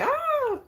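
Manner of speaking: very high excited squeal "ah". Retold or imitated. Describing how she gets when excited, high squeal. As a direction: squeal with sudden glee. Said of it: "when I get excited, boy, I just be like".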